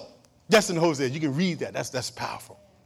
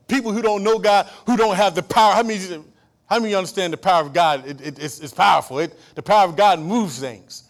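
loud, high, driving sermon voice; full-force preaching; 5 semitones higher, 10 dB louder than usual. Acted. Preacher rousing the congregation about God's power, loud preaching. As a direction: preach it hard to the back pews.

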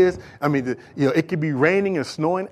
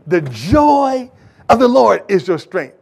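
louder and slow, weight on joy; emphatic repeat; 4 semitones higher, 5 dB louder, slower than usual. Acted. Preacher repeating the key verse to the congregation, slow and emphatic. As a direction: hammer the verse home. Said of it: "do you hear that".

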